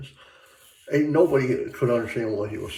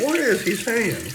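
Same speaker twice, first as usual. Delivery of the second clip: baffled question; 12 semitones higher, 4 dB louder than usual. Retold or imitated. Retold confused question to family.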